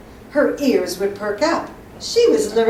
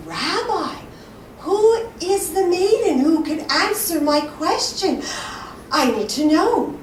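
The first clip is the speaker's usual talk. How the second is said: eager plea; 7 semitones higher than usual. Acted. Young man begs rabbi to name maiden, eager plea. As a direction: press the rabbi, desperate to know.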